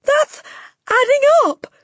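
put-on loud, very high boy's cry; Wilfred. A narrator's reading. Boy protesting at the headmistress's sum, high-pitched cry. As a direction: protest in a squeaky panic. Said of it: "cried Wilfred".

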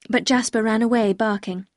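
ordinary delivery

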